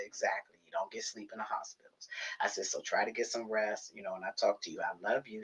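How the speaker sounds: soft, quiet goodbye; softly. Retold or imitated. Daughter softly saying goodbye to mom, quiet. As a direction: say it softly and gently.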